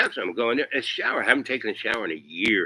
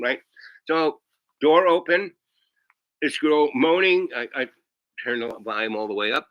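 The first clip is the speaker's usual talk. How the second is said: normal talking voice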